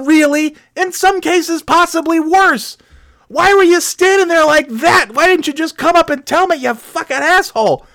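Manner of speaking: high, indignant, outraged scolding; exasperated rant. Retold or imitated. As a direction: tear into him in disbelief. Ranting at the stranger.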